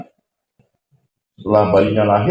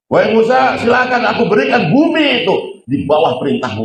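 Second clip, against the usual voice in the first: much higher, solemn proclamation; voice of God; 10 semitones higher than usual. Acted. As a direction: announce it like a divine decree. God speaking to Musa. (suara Tuhan yang agung)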